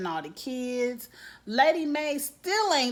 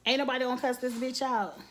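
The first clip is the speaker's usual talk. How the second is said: usual speaking voice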